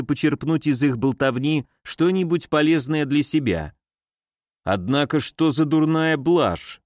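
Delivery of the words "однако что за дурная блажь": usual speaking voice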